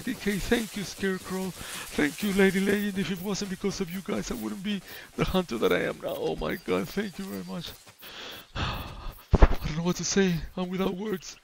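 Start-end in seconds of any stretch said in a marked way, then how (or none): none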